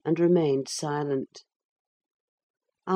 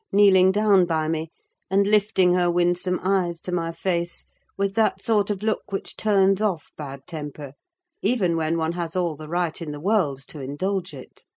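ordinary delivery

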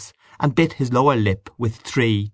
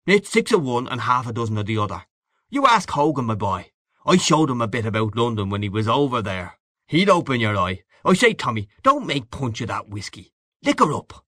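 hearty boastful Gallagher figure. Acted. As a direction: boast like a worldly old pal, full of relish. Gallagher teases his friend.

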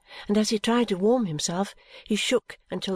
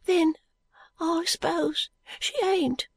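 put-on high, slow boy's voice; muttering boy; 10 semitones higher, slower than usual. A narrator's reading. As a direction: concede it slowly as a sick boy. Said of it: "the boy muttered".

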